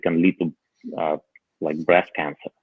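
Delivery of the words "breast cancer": ordinary talk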